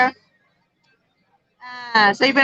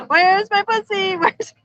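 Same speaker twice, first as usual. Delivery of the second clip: higher, drawn-out imitation of a small child; little daughter calling; 8 semitones higher, slower than usual. Five-year-old daughter home from school, imitated high searching call. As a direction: mimic a five-year-old looking for her cat. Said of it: "our daughter, she's five".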